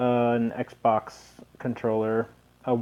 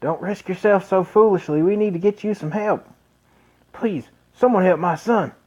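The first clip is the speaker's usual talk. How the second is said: worried father; 7 semitones higher, 3 dB louder than usual. Acted.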